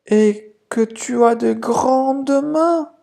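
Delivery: put-on a child marvelling at the hands; little girl exclaiming. (la fillette s'exclame, voix aiguë et lente)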